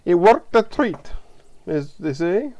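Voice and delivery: put-on a quick playful English accent on the phrase; half English, half Australian accent, hammed up; weak English accent. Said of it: "That's my weak English accent, or Australian".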